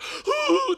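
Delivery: high, loud hammed-up sob; imitated bawling gasp; mock sobbing wail. Man crying on the instructor's lap, mock sobbing wail. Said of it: "sitting on your lap and crying".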